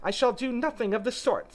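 high, loud, indignant mouse; offended refusal. A narrator's reading.

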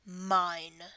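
loud, firm single word; possessive bark. Pretend. Dominant claiming a partner, loud possessive word. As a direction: claim them with force.

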